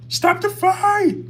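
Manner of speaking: very high, drawn-out cry; mock yelled game voice. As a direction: imitate the game's high, slow yell. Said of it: "somebody's yelling".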